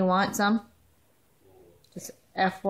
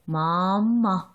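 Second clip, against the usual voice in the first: dropped, drawn "Mama" with falling tune; lower teasing mama. Shown as a demonstration. Mother coaxing baby to say mama. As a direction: coax "Mama" low and slow.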